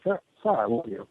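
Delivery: soft, low and tender; tender softness. Retold or imitated. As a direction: hush it down and say it with love. Speaking tenderly to bitter old man.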